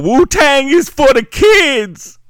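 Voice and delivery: high, loud, slow shout; yelling. Retold or imitated. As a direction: bellow it like a rapper at an awards show. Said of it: "he did not yell".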